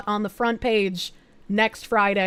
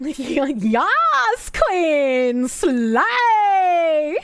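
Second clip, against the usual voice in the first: sassy cheer; 3 semitones higher, much slower than usual. Pretend. Mock cheer.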